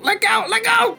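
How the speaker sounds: high goblin squeal; panicked little goblin shrieking. Acted. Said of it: "You hear him let out".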